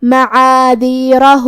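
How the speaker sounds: معاذیرہ with the final ha drawn out; slow معاذیرہ, vowel on the ha stretched; lengthened ha. Shown as a demonstration. Teacher shows drawn-out final ha in معاذیرہ. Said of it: "یہ مد کو ظاہر کریں گے".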